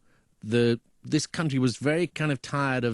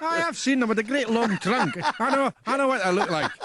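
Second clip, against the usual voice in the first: put-on high-pitched comic Scottish accent; 10 semitones higher than usual. Scot telling of the creatures they've got in Scotland, high Scottish accent. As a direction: play an amazed Scot, voice pitched up.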